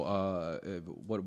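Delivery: ordinary talk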